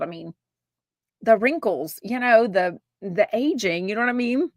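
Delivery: ordinary talk, nothing marked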